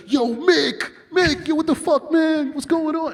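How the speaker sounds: high, loud re-enacted yelling down the phone; retold phone screaming. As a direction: holler as if he can't hear you. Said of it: "I'm screaming on the phone".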